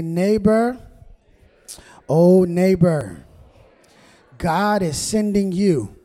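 loud, stretched-out call with a smile; big-smile greeting. Shown as a demonstration. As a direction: lead the congregation in a slow, ringing call. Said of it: "with a nice big smile and say".

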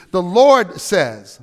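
The Lord says drawn out slow and strong; slow emphasis. Shown as a demonstration. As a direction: stretch out "The Lord says" for weight. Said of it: "who says this?".

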